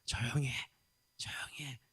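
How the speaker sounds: whispered shush; hushed scolding. Retold or imitated. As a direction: hiss it quietly to silence him. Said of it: "이렇게 꾸짖는 거예요". (속삭이듯 꾸짖는 목소리)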